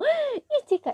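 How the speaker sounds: sudden high, loud excited yelp of her name; thrilled, shrieking recognition; excited gasp. Retold or imitated. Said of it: "Y se emociona".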